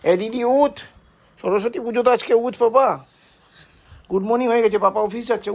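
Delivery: high, loud wake-up call. Real, not acted. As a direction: call up loudly to get her out of bed. Calling someone to wake up.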